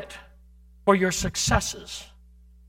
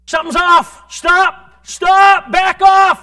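urgent shout; 9 semitones higher, 13 dB louder, much slower than usual. Acted. Preacher warning, escalating.

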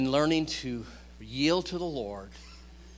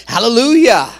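full-voiced, high shout of praise; shouted hallelujah; 8 semitones higher, 16 dB louder than usual. Real, not acted. Preacher exulting before the congregation, loud and high. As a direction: belt out the hallelujah.

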